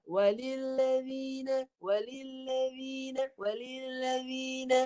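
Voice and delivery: walilladzina with dh from the tongue tip, drawn out; slow tongue-tip dh in walilladzina; careful Quranic walilladzina, tongue tip dh. Shown as a demonstration. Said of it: "yang ni hujung lidah".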